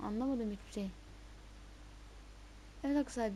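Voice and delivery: ordinary talk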